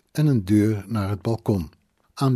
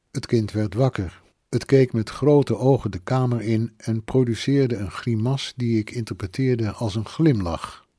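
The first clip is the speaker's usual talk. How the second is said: ordinary delivery, nothing marked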